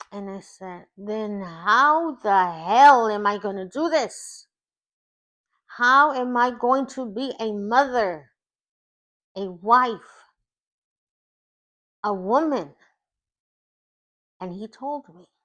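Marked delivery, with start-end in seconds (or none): 1.0-12.8 anguished shout. Retold or imitated. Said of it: "I screamed".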